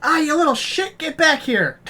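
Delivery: high, exasperated yell; high-pitched mock scolding; cursing dog trainer. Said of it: "Especially while training dogs".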